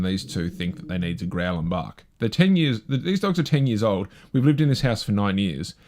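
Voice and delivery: normal talking voice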